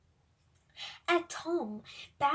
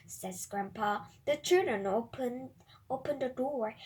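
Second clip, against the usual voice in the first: ordinary delivery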